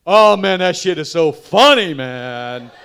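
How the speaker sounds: loud, high, booming fan voice; big loud fan impression. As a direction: shout it like an excited fan.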